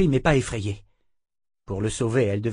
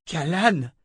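broken voice; 6 semitones higher than usual. A narrator's reading. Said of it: "souffla Richard d'une voix brisée".

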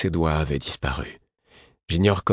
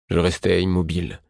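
ordinary delivery

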